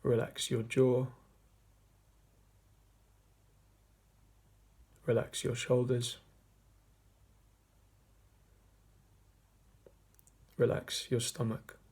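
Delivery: measured meditation voice with long pauses; guided meditation. Acted. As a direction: guide listeners gently inward, leaving long silences between phrases. Leads a meditation.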